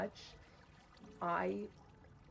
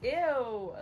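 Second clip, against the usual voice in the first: loud, higher disgusted ew; 6 semitones higher, 8 dB louder than usual. Real, not acted. Disliking the grumpy-lead trope, loud disgusted ew. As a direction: recoil with a loud ew.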